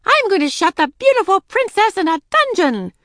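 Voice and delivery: put-on high, loud child's witch threat; play-acting wicked witch. A narrator's reading. Daisy as witch threatening princess, high loud menace.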